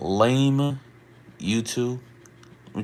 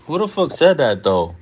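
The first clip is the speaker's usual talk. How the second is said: loud, higher, demanding; indignant demand; 6 semitones higher, 7 dB louder than usual. Real, not acted. Streamer confronting chat over an insult, loud and indignant. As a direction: call out the commenter. Reacting to racist comment.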